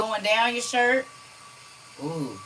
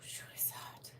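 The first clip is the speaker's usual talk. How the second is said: whisper; 22 dB quieter than usual. Real, not acted. Muttered to self while hot.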